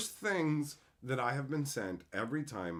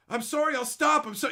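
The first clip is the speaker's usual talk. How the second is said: mock whiny apology; 12 semitones higher, 9 dB louder than usual. Mimicking stalker kid apologizing.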